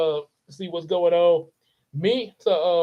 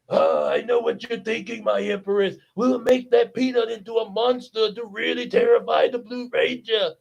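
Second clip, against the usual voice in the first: Gold as villain minion; 4 semitones higher than usual. Retold or imitated. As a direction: purr it like a scheming servant to his empress. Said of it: "Gold is like".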